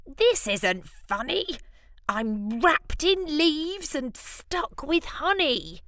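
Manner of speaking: a hint of a trapped villain whining; lightly voiced indignant wizard. Wizard lizard trapped in leaves, mild whine.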